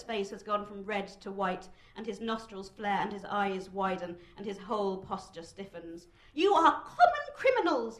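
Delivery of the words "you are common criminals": high, loud, strained scolding; thin-voiced indignant outburst; angry rebuke. A narrator's reading. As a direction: spit contempt at the crowd. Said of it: "does not speak loudly enough for his words to reach".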